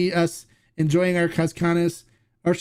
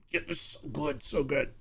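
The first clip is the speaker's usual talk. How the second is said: hushed, blissful moan, much softer; ecstatic hush; 3 semitones lower, 11 dB quieter, slower than usual. Acted. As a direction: breathe it out like pure bliss. Blissful moan over the tequila.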